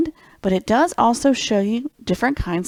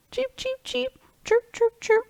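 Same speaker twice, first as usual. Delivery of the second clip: tiny high cheeping chirps; squeaky high birdcall imitation; bird noises. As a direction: chirp like a little bird for the kids. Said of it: "You gotta work on your bird noises".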